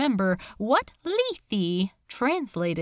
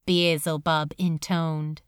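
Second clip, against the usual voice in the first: ordinary delivery, nothing marked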